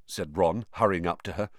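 ordinary delivery

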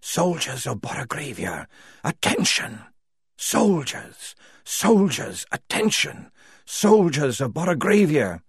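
thin voice with a ghostly whisper underneath; a reedy girl's call doubled by an echoing whisper; echoing shadow whisper. Acted. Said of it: "a whisper that filled and refilled".

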